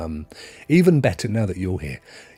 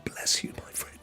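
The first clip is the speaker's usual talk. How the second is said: touched whisper; 13 dB quieter than usual. Real, not acted. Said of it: "I'm choking up".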